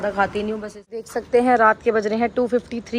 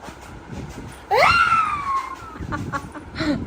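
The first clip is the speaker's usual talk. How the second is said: burst of laughter. Real, not acted. Mother laughs at child's vow.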